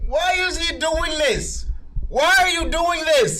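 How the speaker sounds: high, slow whiny complaint; mock whine. Real, not acted. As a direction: whine at the screen. Whining at video.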